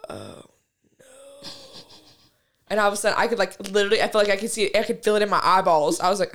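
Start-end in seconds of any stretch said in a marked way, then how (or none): 0.1-1.0 low, soft, sinking oh no; dread. Retold or imitated. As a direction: let it drop into quiet dread. Realizing she is too high.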